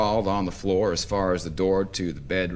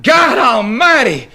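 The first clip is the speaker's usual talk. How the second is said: high, loud cry of surprise; yelled out; 14 dB louder, slower than usual. Acted. Myrtle crying out to God in bed, high loud yell. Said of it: "she yelled out".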